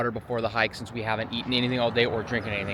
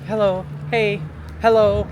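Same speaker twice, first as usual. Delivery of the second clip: friendly trail greeting; 9 semitones higher, 5 dB louder than usual. Retold or imitated. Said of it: "it's always like".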